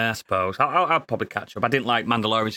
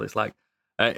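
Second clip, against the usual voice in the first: ordinary talk, nothing marked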